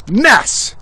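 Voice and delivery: MESS thrown out high; exclaimed emphasis. Real, not acted. Repeats his verdict on the VA.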